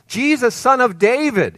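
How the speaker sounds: a blind beggar shouting for Jesus; shouting from the curb. Retold or imitated. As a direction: call out across the street to him. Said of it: "him shouting from the curbside".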